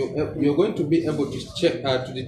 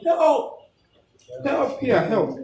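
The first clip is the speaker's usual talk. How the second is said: shouting for help; 10 semitones higher, much slower than usual. Shown as a demonstration. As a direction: yell for help mid-compressions. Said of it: "you're still shouting".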